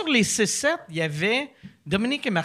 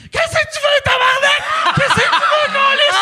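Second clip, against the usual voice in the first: high furious shout, much louder; angry yelling; 6 dB louder than usual. Retold or imitated. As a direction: scream it at the intruder. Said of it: "je suis comme".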